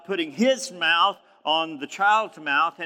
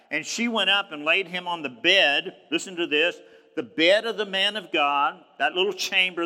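normal talking voice

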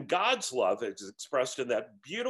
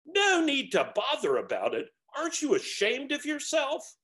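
much higher, incredulous and outraged; scandalized ghost; 13 semitones higher, 3 dB louder than usual. A narrator's reading. Ghost scolds the unashamed spirit, outraged. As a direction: recoil in disbelief.

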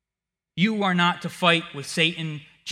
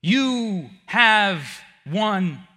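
very slow, loud declaration; triumphant; 6 semitones higher, 8 dB louder, much slower than usual. Acted. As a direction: proclaim the victory. Triumphant climax.

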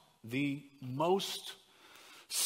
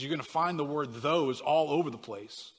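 ordinary talk, nothing marked

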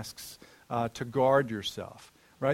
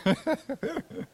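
high laugh; 12 semitones higher than usual. Real, not acted. Laughs at own clickbait example.

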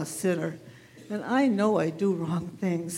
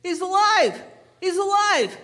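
high, loud joyful call; shouting; 9 semitones higher, 4 dB louder than usual. Retold or imitated. Runner shouting the good news to the shepherd, loud and high. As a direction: shout the news as you run past. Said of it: "and he shouted".

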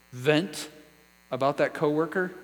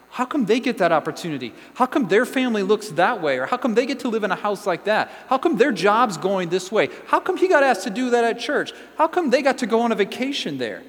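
higher, louder indignant questioning; aggrieved, jealous griping in a raised voice; envious complaint; 6 semitones higher, 6 dB louder than usual. Acted. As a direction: protest how unfair it is, pitched up and louder. Acting out an envious person's complaints.

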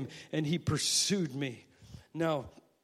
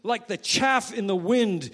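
psalm cried out; 7 semitones higher, 6 dB louder than usual. Retold or imitated. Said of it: "when he cries out".

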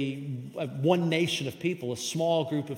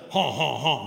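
hammed-up French "haw haw haw"; mock French; 4 dB louder than usual. Preacher joking about his poor French, mock French laugh. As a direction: fake a silly French laugh. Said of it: "That's my French".

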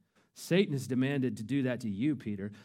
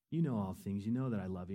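soft hurt reply; 6 semitones lower, 6 dB quieter, faster than usual. Retold or imitated. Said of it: "And Peter says".

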